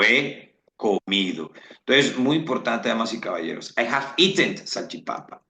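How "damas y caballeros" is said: ordinary delivery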